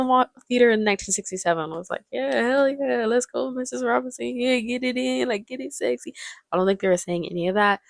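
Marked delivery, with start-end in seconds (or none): 2.1-6.1 mock cheering. Imagined audience cheering her on.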